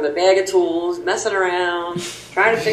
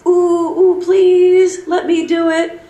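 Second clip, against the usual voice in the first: high-pitched, loud begging; mock eager pleading; 11 semitones higher, 4 dB louder, slower than usual. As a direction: beg like an overeager volunteer. Mock begging to take the test.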